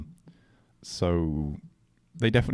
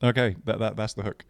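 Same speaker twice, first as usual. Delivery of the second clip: normal talking voice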